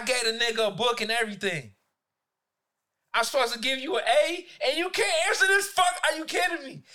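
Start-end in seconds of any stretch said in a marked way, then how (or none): 3.1-6.8 rising, louder disbelief at a student; higher and louder, can't believe it; exasperated scolding. Retold or imitated. Teacher scolding a student who can't answer, exasperated.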